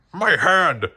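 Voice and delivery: loud, high glad shout. A narrator's reading. Combat Carl shouting from inside the cabinet. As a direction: call out in surprise. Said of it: "Combat Carl shouted".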